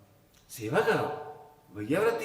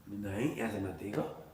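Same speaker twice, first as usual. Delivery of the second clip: soft correction; 10 semitones lower, 10 dB quieter, slower than usual. Shown as a demonstration. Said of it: "ऐसे देखो".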